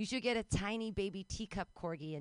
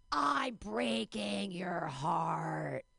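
extremely slow mock threat; menacing child torturer; much slower than usual. Twins aiming a laser pointer at nanny's chest, drawn-out mock menace. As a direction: stretch every word out like a playacting villain.

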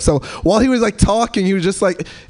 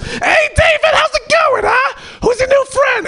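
high shouted greeting, screechy; loud screech. Retold or imitated. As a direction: screech across the section. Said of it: "she's just, like, loud".

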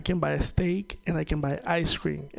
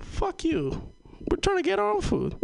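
pitched way up, incredulous; high disbelieving rant; mock outrage; 13 semitones higher than usual. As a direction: play the offended Venezuelan. Outraged reply to jail food.

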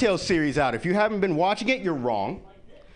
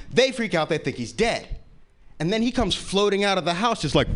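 ordinary delivery

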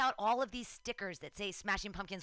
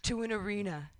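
lower-voiced quote of the singer; 5 semitones lower, 3 dB louder than usual. Singer addressing the arena, in a lowered voice. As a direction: drop lower for the rock frontman. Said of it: "Billy Corgan gets, he says".

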